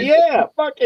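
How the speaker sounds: squeaky falsetto yeah; high mock agreement. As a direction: chirp it way up high, playing along. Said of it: "I'm just like".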